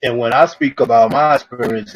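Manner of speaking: usual speaking voice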